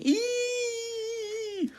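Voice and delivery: long, very high-pitched shriek; thin squealed shriek of fright. A narrator's reading. Mother terrified of a spider, shrieking. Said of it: "so she was just shrieking".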